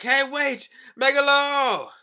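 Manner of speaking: high, loud call to wait. Pretend. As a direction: shout to stop the shark leaving. Minister calls after the shark.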